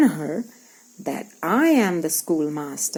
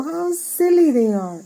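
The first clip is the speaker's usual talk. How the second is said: mock big-kid scorn; 8 semitones higher, 3 dB louder than usual. Older kids mocking babies.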